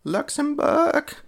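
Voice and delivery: very high, slow announcer impression; mock announcer call, high and drawn out; obnoxious commentator. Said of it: "It sounded fucking obnoxious accent".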